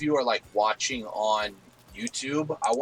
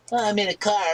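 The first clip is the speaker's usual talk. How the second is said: high squeaky mocking quote; 11 semitones higher, 5 dB louder than usual. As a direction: mimic him in a high silly voice. Mocking friend's claim, high voice.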